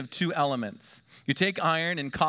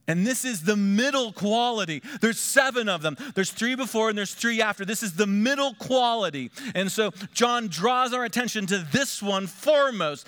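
full-force preaching; 6 semitones higher than usual. Acted. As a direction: preach it out to the whole room.

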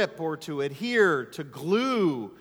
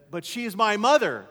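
higher, louder son's objection; protesting son; 4 semitones higher, 6 dB louder than usual. Retold or imitated. Son objecting to his father's rebuke, protesting. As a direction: push back in a raised voice.

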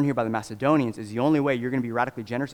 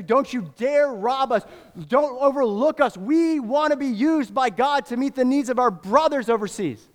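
higher, louder urgent plea; earnest begging; 12 semitones higher, 6 dB louder than usual. Retold or imitated. Poor believers insist to Paul on giving, high urgent plea. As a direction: implore Paul not to rob them. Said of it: "they're begging earnestly, saying".

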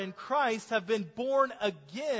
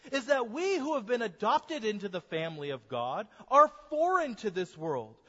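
usual speaking voice

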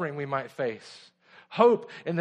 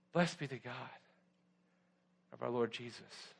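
much softer, lower devotional recital; hushed reverence; 5 semitones lower, 9 dB quieter than usual. Real, not acted. Preacher turning the congregation's eyes to Jesus, hushed and reverent. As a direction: let it sink low and soft, with long pauses.

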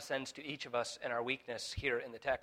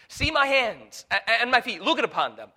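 urgent Jesus; 9 semitones higher, 12 dB louder than usual. Acted. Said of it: "he asks them to touch him".

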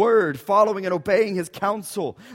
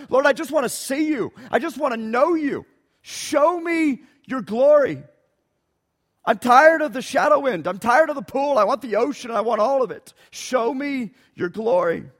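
high, pleading voice rising to a near-shout; pleading cry; 6 semitones higher than usual. Acted. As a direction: beg God like Moses, straining upward. Preacher voicing Moses' plea.

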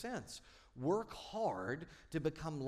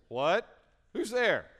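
louder, higher startled what; called out through a closed door; a woken clerk calling who's there; 7 semitones higher, 10 dB louder, slower than usual. Retold or imitated.

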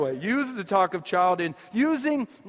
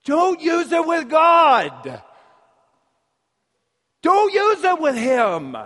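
forceful warning; 7 semitones higher, 6 dB louder, slower than usual. Real, not acted. Preacher driving home his point.

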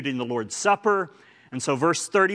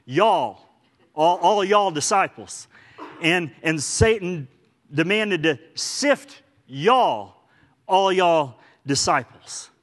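drawn-out Oklahoma "y'all", louder and slower; 3 semitones higher, 5 dB louder, slower than usual. Shown as a demonstration. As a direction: lean into a folksy Oklahoma "y'all". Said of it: "meaning like a good Oklahoman".

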